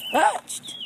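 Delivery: high, loud disbelieving question; one-word outraged double take; shocked echo. Acted. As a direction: blurt it back in alarm.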